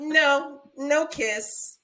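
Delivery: high, loud mock scolding; firm refusal. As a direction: act out firmly refusing a child. Acted refusal to creepy man-child.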